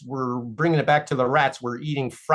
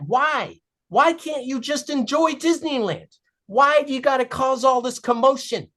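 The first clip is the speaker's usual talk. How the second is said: exasperated family; 10 semitones higher than usual. Acted. Family upset in story.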